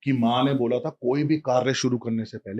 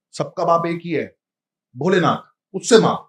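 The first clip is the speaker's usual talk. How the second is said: rising outburst; 5 semitones higher, 6 dB louder than usual. Retold or imitated. Mother snaps, voice rising.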